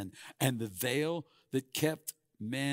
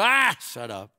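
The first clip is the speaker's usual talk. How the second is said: dismissive crowd; 8 semitones higher, 13 dB louder than usual. Pretend. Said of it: "They're gonna go".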